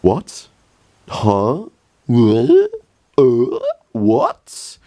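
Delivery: genuinely confused by what he sees; baffled. Real, not acted. Reacting to absurd clip.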